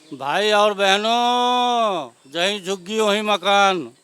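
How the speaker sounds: long drawn-out mimicry of a politician's bellow; mock rally shout. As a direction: stretch each word like a speech to a crowd. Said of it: "चिल्लाते थे गला फाड़ फाड़ के". (नेता की नकल में खींच-खींचकर चिल्लाते हुए)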